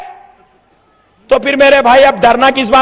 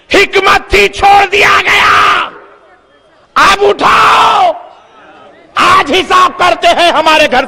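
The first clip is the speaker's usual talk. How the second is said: preaching at full force. Acted. Preacher warning of God's judgment over the Babri mosque, shouted preaching. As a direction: thunder God's reckoning at them.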